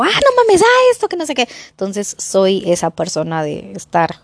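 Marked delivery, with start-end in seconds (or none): 0.0-1.5 high, loud frightened yelp at the dinosaur; startled scream. Retold or imitated. [name] at the movies, screaming in fright. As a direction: shriek like the dino just jumped out. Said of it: "y yo".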